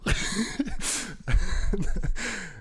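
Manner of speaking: high-pitched laughing fit; big, long high laugh; burst of laughter. Real, not acted. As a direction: laugh long and high at your own story. Laughs at own story.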